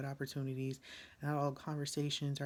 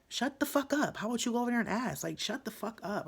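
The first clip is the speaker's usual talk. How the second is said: much higher, much louder, rapid outburst; venting real rage at the woman who keeps prying; furious shout; 9 semitones higher, 6 dB louder than usual. Real, not acted. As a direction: scream it at the nosy lady. Snapping at the prying lady.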